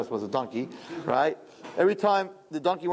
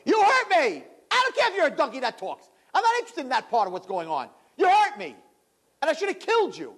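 hurt and furious; 11 semitones higher than usual. Acted. Bilam shouts at the donkey that hurt him, furious. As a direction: yell it like the pain blinds him. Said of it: "he doesn't think about".

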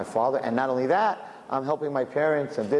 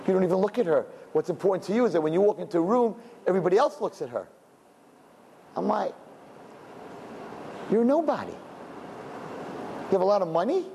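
heated retold rebuke; 5 semitones higher than usual. Rabbi retells confronting rich man.

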